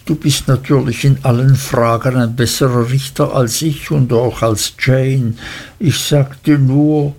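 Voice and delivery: plain reading voice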